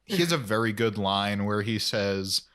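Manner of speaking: ordinary talk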